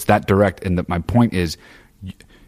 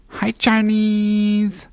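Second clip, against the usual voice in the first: very high, slow cooing call; dog-talk voice; 14 semitones higher, much slower than usual. Shown as a demonstration. Calling the dog Charlie in a squeaky pet voice. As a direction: coo it up at the dog like baby talk. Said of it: "That's how I talk to my dog".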